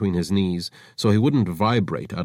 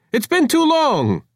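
much higher, louder, slow gushing; forced delight; 6 dB louder than usual. Acted. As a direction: gush with a pasted-on smile. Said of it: "pasted a delighted smile".

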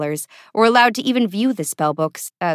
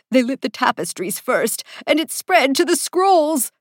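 high, anxious alarm; climbing in pitch with dread; panicked; 7 semitones higher than usual. A narrator's reading. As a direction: report the spreading disaster frantically. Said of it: "the most anxiety-prone".

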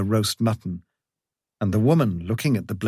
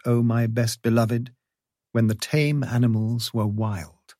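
plain reading voice

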